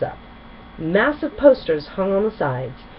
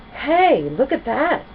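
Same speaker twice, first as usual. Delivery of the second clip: high, louder excited shout; 8 semitones higher, 4 dB louder, slower than usual. A narrator's reading. Rainbow Dash pointing out the words, shouting. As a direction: call everyone to look. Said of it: "Rainbow shouted".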